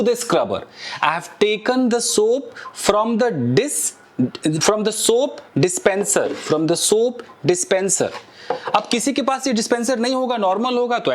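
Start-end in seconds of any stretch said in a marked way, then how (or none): 1.0-8.1 slow, careful English model sentence. Shown as a demonstration. Teacher modeling an English phrase slowly for students. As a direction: spell it out slowly for learners. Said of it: "लगाने को मैं क्या बोलूंगा".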